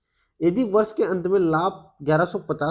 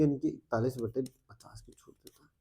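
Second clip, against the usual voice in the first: whispered working; 3 semitones lower, 8 dB quieter than usual. Real, not acted. Teacher working the fraction aloud.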